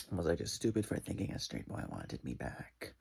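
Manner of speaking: dropped, low voice of self-doubt; low mock self-reproach; low self-questioning. Turns the question on herself.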